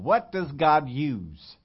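slow, weighty teaching; slow emphatic. Shown as a demonstration. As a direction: drag out each word for emphasis. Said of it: "you gotta learn this thing".

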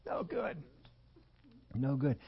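softly voiced mock disgust. Other people looking at his cereal, quietly disgusted. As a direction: turn up your nose at it, in small bursts. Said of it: "look at that and say".

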